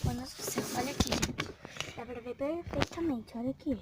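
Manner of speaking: frightened hushed whisper; scared whisper. Real, not acted. Scared whisper showing the hole. (sussurro assustado)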